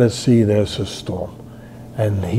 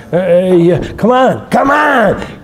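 rising, higher mock yell daring the punches; building to a high, louder dare; taunting yell; 6 semitones higher, 4 dB louder, slower than usual. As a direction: taunt the opponent to hit harder.

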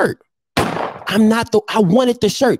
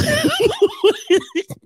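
high laugh. Real, not acted.

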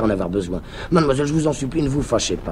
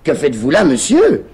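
high, louder prim woman's voice; mock offended lady; 6 semitones higher, 9 dB louder than usual. Tamise mimicking a startled girl, high and prim. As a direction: play the shocked young lady, loud and high. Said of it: "elle te dira".

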